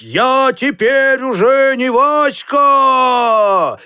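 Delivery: long steamship hoots into high, drawn-out calls; slow, high, stretched-out storybook shouts; boy crying out. A narrator's reading. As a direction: hoot and call out like a boy playing steamship. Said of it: "и кричал он".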